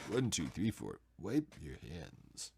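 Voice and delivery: low, slow, drawn-out impression; Sulu. Repeating the count as a hammed-up low Sulu impression. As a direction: lean into a deep, measured starship-officer voice. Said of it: "why I say that like Sulu".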